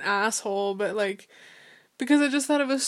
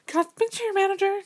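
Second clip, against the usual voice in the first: higher, quick, louder customer voice; retold manager request; 10 semitones higher, 5 dB louder, faster than usual. Customer to somebody else at the restaurant, high and quick. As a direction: ask for the manager in a high, hurried pitch.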